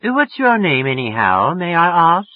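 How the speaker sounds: high, hoity English lilt; affected English accent. A narrator's reading. Woman asking the caller's name, affected English accent. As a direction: swoop up into a put-on English accent. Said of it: "She was getting an English accent".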